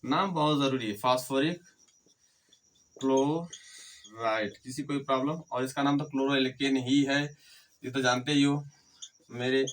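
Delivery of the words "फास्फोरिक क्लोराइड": Chloride very slow, syllables pulled apart; slow dictation. Shown as a demonstration. Teacher finishing the compound name for note-takers, slow dictation. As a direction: draw out chloride as if writing it.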